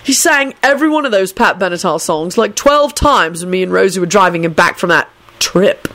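ordinary delivery, nothing marked

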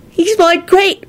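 put-on high, drawn-out little boy's reply; mildly tearful high voice; sniffing child. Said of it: "sniffed Teddy and blew his nose".

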